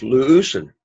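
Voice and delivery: "Leusen" accented on its opening "leu"; first-syllable stress. Shown as a demonstration. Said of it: "accent is on the first syllable".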